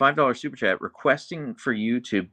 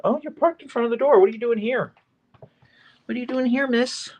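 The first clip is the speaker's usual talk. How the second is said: high, sing-song pet voice; 12 semitones higher than usual. Real, not acted. Owner cooing at her husky blocking the door, high baby talk.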